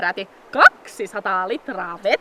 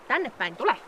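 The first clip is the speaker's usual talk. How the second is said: usual speaking voice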